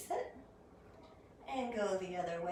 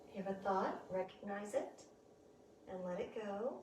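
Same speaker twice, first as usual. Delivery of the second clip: soft spa whisper; 9 dB quieter than usual. Acted. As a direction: let each cue drift out quietly. Guided breathing during relaxation.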